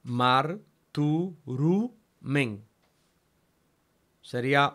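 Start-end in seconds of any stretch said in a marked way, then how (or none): 0.0-1.9 slow reading. Shown as a demonstration. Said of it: "Miren el otro caso".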